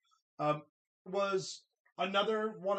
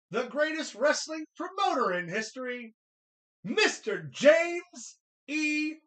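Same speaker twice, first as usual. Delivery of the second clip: booming ring announcer intro, building louder; 5 dB louder than usual. Pretend. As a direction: announce him like a big arena star.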